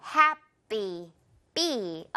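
Happy broken slowly into Hap and a tight bee; Happy with a light, falling second syllable; tense unaspirated p. Shown as a demonstration. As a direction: say the py of Happy as an airless B. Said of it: "어떻게 소리 난다".

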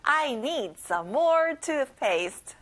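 very slow, every word laid out; slow model phrase. Shown as a demonstration. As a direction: say it slowly for the kids to copy. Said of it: "Let's practice together".

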